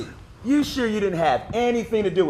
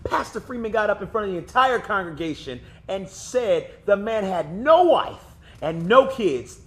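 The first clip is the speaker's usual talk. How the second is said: ordinary talk, nothing marked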